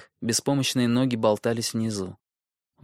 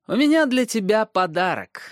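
high, smiling and sly; smiling dwarf voice; 10 semitones higher, 4 dB louder than usual. Acted. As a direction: offer a gift with a grin. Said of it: "улыбнулся карлик Брану". (с улыбкой, высоким голосом карлика)

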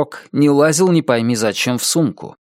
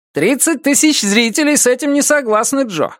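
high-pitched radio sidekick; high-voiced commentator disagreeing with his partner; objecting co-commentator; 12 semitones higher than usual. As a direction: protest in a high character voice.

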